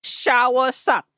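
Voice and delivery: high, slow mock Taiwanese accent. Streamer doing the special voice for viewers, mock Taiwanese accent. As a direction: draw it out in a high-pitched, hammed-up Taiwanese accent. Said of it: "the, the Taiwanese voice".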